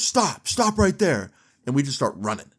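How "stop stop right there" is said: high, slow call to halt; retold commanding shout. Security guard to fleeing boys, commanding shout. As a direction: call after someone getting away.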